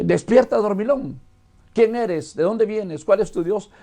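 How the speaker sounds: rousing sailors. Acted. Sailors waking Jonah asleep in the boat, rousing him with questions. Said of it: "Llegan, le dan una patadita todavía muy amistosa y dicen".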